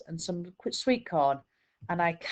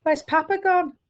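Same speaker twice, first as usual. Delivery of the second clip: put-on crying child; 11 semitones higher, 5 dB louder than usual. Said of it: "I start to cry".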